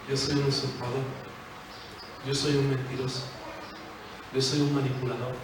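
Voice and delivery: low, soft, ashamed admission; humble confession. Pretend. Jacob admitting his sins to God, low and soft.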